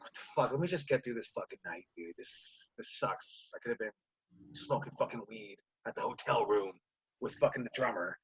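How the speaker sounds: low, hushed grumble to himself; grumbling inner thought. Retold or imitated. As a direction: mutter it under your breath, fed up. Grumbling thoughts on walking into yoga.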